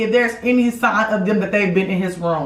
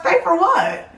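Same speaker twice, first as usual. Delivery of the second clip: incredulous; 9 semitones higher than usual. Retold or imitated. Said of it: "And I was like".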